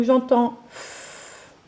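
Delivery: "F" drawn out slowly; sounding out slowly. Shown as a demonstration. Teacher slowly sounding out "F" for a pupil. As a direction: stretch the "F" sound. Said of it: "fait par la lettre M".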